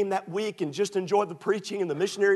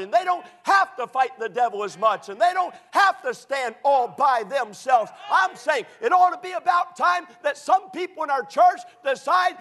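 higher and louder, building to a shouting preacher's peak; preaching at full force; 10 semitones higher, 6 dB louder than usual. Acted. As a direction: shout it out over the congregation, climbing as you go. Preaching climax.

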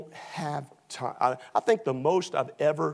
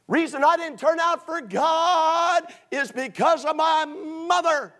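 whiny excuse maker; 13 semitones higher, 4 dB louder, slower than usual. Retold or imitated. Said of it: "I have people all the time say".

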